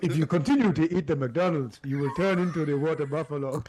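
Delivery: put-on a light Nigerian accent, laughing through it; mock Nigerian parent voice, giggling. Friend asked to play parents in a Nigerian accent, laughing. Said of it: "Give me your best Nigerian accent".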